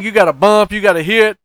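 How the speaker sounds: usual speaking voice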